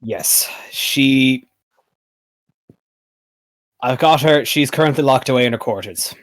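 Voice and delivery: halting, weighty admission; grave royal speech sinking at the end; troubled city ruler. Acted. Game master speaks as Pythor.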